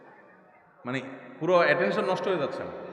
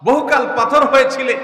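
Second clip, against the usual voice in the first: impassioned poetry line; 8 semitones higher, 9 dB louder than usual. Real, not acted. Poetic loud line.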